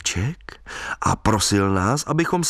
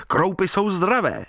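much higher jaunty call; cheerful call; 8 semitones higher than usual. Retold or imitated. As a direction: cheer them on gaily. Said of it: "volal jsem vesele".

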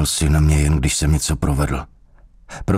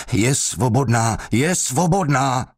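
high, slow cry without much volume; desperate cry; 8 semitones higher, slower than usual. A narrator's reading. Beaten prisoner cries out to escape the blows, high and desperate. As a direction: cry it out higher, drawing out each word. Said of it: "křičel, aby se zbavil rozzuřené mlátičky".

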